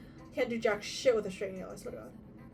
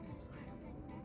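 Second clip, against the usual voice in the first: whispered; 17 dB quieter than usual. Real, not acted. Resigned aside.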